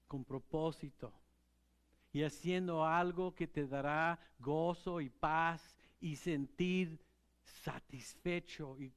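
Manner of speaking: normal talking voice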